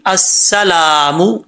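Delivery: slow अस्सलामु, holding a thin doubled s. Shown as a demonstration. Teacher showing the shadda, careful recitation. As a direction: lean on the s, then stretch "laa" in अस्सलामु. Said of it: "मो पढ़ेंगे mistake हो जाएगी".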